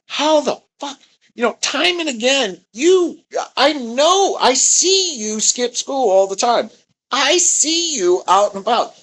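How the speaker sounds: high, loud mock outraged girl voice; livid classmate. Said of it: "She was livid".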